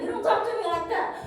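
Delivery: high, fast, indignant comeback; angry retort. Retold or imitated. She angrily tells off the rude vendor. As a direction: fire it back at him. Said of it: "and I'm mad".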